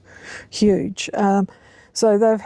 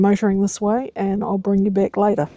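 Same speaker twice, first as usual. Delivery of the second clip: normal talking voice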